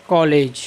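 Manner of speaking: कॉलेज with an open "aw" vowel; English-style rounded vowel in कॉलेज; English pronunciation. Shown as a demonstration. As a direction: say "kaw-lej" for कॉलेज. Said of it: "अंग्रेजी में इसका उच्चारण किस प्रकार से होता है".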